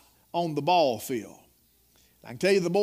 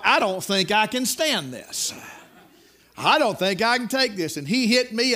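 higher, louder preacher building the story; preaching at full force; 3 semitones higher, 4 dB louder than usual. Acted. Preacher telling his congregation of the paddling, full-force preaching. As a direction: preach it loud, rising with the tale.

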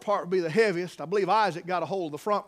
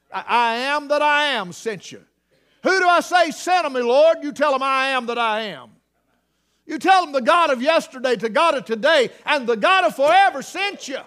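loud, higher sermon delivery; preaching at full force; 7 semitones higher, 9 dB louder than usual. Acted. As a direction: proclaim God's name to Moses, loud and high. Preacher voices God and Moses loudly.

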